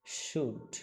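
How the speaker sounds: "শুড" as one syllable with a silent L; pronunciation model. Shown as a demonstration. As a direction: model "শুড" clearly, no L sound. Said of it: "এল এর কোনো উচ্চারণ নাই".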